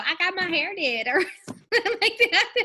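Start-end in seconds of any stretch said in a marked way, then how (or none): 0.0-1.1 a high, playful put-on voice for a silly selfie; tossing off a jokey catchphrase in a goofy voice; mock silly voice. Friend mimics the big-eyes filter voice, goofy and high. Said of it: "you go".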